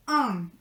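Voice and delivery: "un" fully nasal, as if the nose were blocked. Shown as a demonstration. Teacher demonstrating the nasal French "un". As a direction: push "un" through the nose like a stuffy cold. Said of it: "completamente nasal".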